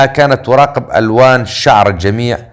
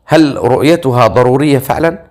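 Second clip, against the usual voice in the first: ordinary delivery, nothing marked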